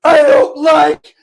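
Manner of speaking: hammed-up anguished shout, high and loud, slow; mock screaming. Fan screaming at a comedian about his team's defense, hammed-up crying shout. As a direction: wail it like a heartbroken fan. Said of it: "not to just start screaming".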